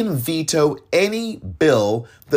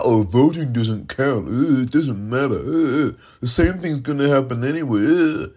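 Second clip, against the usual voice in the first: hesitant mock voice with ums; mocking quote. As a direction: parody a mumbled excuse. Mocking non-voters' excuses.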